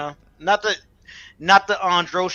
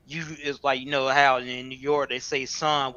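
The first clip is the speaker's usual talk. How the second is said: ordinary delivery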